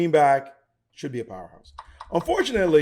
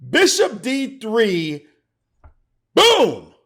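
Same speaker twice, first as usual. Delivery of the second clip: slow, high, loud announcement ending in a bang; dramatic reveal; 7 semitones higher, 6 dB louder, much slower than usual. Real, not acted. Chess commentator reveals the winning move, dramatic reveal. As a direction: proclaim it, then explode on the last word.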